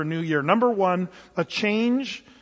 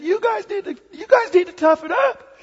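much higher, louder coach barking at players; high-pitched coach; 12 semitones higher, 5 dB louder than usual. Retold or imitated. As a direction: mimic the coach getting tougher and higher. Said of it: "his voice would get higher".

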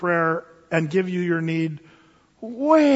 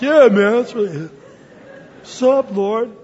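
louder laid-back slang hello; casual dude greeting; 2 semitones higher, 6 dB louder than usual. Acted. Believer greeting the Lord like a buddy, loud and laughing. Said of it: "It's like".

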